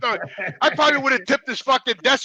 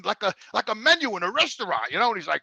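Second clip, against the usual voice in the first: ordinary talk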